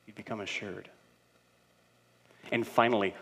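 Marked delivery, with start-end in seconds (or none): 0.0-0.9 hushed, much softer voice; acting out a quiet, patient reply; gentle counselor. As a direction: answer anger softly, as a counselor would. Retelling counselor's gentle reply to anger.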